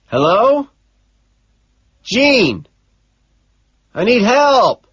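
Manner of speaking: shouting. Acted. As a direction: shout for help down the phone. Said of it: "I shout".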